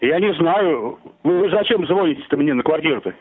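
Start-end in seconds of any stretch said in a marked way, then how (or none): none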